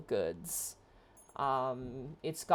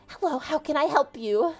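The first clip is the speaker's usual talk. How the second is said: put-on high cheery gnome girl; bright little shopkeeper greeting, high; pixie; 10 semitones higher, 4 dB louder than usual. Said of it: "and she goes".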